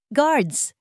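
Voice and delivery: high, sharp call for the guards; commanding shout. A narrator's reading. Eliza shouts for guards at the party, commanding. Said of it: "sigaw ni Eliza".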